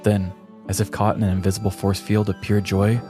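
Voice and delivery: plain reading voice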